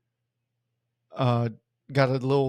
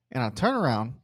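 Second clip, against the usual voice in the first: normal talking voice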